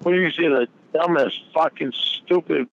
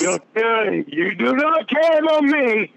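higher, slower insistent accusation; 5 semitones higher than usual. Real, not acted. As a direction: stretch it out and push it. Shouted accusation.

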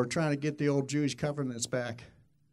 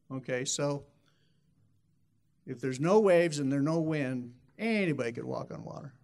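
ordinary talk

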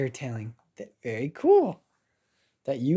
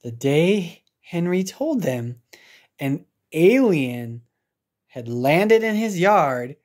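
ordinary delivery

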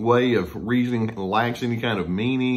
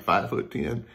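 deadpan husband; 4 semitones lower, 5 dB quieter than usual. Retold or imitated.

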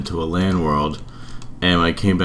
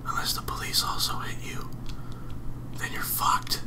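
hushed whisper, mom asleep nearby; 11 dB quieter than usual. Real, not acted. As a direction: whisper so nobody wakes. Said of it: "I'd probably yell about it right now, but I don't wanna wake my mom up".

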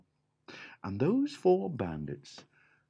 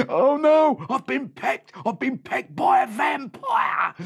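high loud crying shout; panicked wail; 9 dB louder than usual. A narrator's reading. Pecked bandit wailing high and loud. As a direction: wail in fright.

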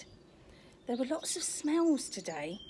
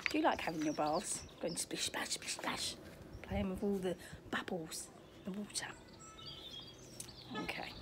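soft whispered aside; 2 semitones lower, 7 dB quieter than usual. Real, not acted. Storyteller asking the children about bath time, whispering. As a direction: lean in and whisper it to the little ones.

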